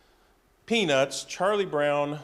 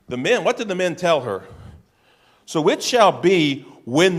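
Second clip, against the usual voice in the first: louder, driving sermon voice; preaching at full force; 4 semitones higher, 7 dB louder than usual. Acted. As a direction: hammer the promise home to the congregation.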